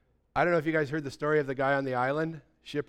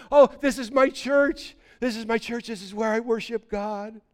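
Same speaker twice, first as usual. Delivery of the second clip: proud castaway; 7 semitones higher, 6 dB louder than usual. Acted. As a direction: present it with joyful pride. Castaway shows off his church.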